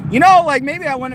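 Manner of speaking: high, loud burst; loud exclamation; sudden loud outburst. Real, not acted. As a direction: blurt it out loud. Sudden outburst mid rant.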